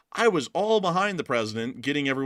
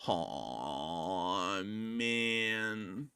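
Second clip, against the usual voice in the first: Hot Dog Man fly; 3 semitones lower, slower than usual. Acted. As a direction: moan like a sad loser fly. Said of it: "voiced by none other than the Hot Dog Man".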